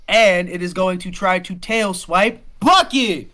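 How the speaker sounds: loud, high game master narration; dramatic, projected announcement; excited attack call. Acted. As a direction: build to a shout on the target.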